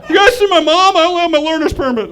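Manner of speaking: put-on high, loud teenage boy voice; hammed-up kid calling for mom; lost fifteen-year-old. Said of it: "I look like a fat fifteen-year-old lost in a Walmart".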